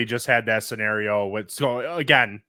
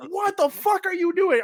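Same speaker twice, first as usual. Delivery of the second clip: mad dad. Retold or imitated. Father scolding his daughter, high exasperated yell. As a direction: scold in a panic. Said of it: "I was so mad".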